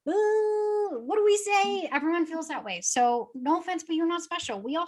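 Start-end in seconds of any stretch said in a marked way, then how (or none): 0.1-1.9 high, drawn-out stumped "ooh"; mock dithering. Agents all struggling with captions, mock dithering. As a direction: play at being lost for words, pitched up. Acting out everyone stuck on captions.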